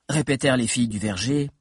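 ordinary delivery, nothing marked

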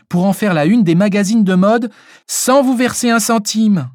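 put-on high-pitched, complaining old lady; lightly voiced old woman airing her grievance; grumbling old lady. A narrator's reading. (vieille dame ronchonne)

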